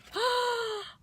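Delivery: sharp high gasp of disbelief; shocked gasp. Retold or imitated. Gasping at how simple the trick is, shocked gasp. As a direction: gasp like the answer was too easy.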